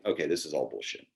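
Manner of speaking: low, quick, dismissive thought; retold doubt, softly tossed off; sceptical inner voice. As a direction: write it off under your breath. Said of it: "there was this skepticism in me".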